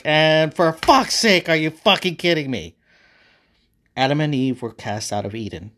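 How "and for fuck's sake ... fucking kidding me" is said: loud, higher, exasperated cursing; furious outburst. Acted. God turns back and catches them disobeying, furious outburst. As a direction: explode mid-sentence in outrage. God discovers the apple was taken.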